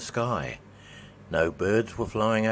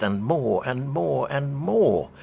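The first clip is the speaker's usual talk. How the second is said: each more climbing higher and louder; building more; 6 semitones higher, 4 dB louder than usual. A narrator's reading. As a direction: pile up each more, growing. Building repetition in narration.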